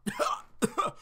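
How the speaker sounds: coughing. Acted. Just nearly drowned, coughing.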